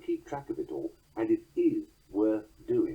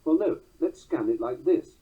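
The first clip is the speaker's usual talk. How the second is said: ordinary delivery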